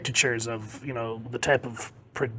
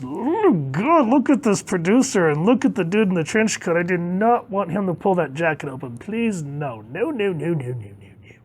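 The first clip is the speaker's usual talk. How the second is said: hammed-up disgust; 6 semitones higher than usual. Reacts in disgust to sleazy artwork.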